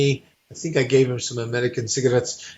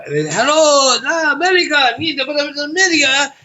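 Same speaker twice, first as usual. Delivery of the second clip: high, loud, sing-song welcome from a stranger; calling out a merry hello to the foreigner; excited foreign greeting; 12 semitones higher, 3 dB louder than usual. Retold or imitated. Train passengers greeting the American.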